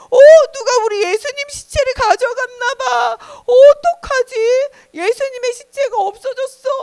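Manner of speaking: high-pitched, distraught Mary; acted cries of panic rising to a wail; shocked, tearful wailing. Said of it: "너무 놀래서 더 큰 소리로 울었어요".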